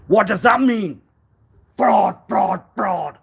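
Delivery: loud, high cries of fraud; outraged shout. Acted. Outraged at the council fraud, shouting it loud and high. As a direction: yell fraud indignantly.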